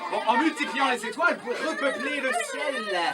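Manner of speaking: loud, high, slow proclamation; kids party magician. Acted. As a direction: declare it like a wonder.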